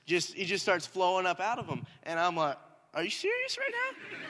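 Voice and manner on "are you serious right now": stunned disbelief. Retold or imitated. Kid amazed prayer worked.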